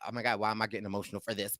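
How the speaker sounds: low, soft, very quick aside; muttered self-questioning. Retold or imitated. Viewer surprised at own emotion, quick low mutter. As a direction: rush it under your breath.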